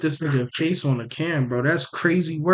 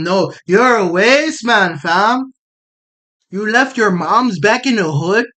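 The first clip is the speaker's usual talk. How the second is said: loud Toronto slang voice, louder; Toronto roadman accent; 5 semitones higher, 4 dB louder than usual. Retold or imitated. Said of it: "The Toronto accent's terrible".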